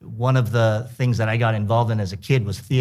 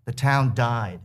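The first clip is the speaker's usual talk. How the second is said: reading aloud; 4 semitones higher than usual. A narrator's reading. Author reads his essay aloud.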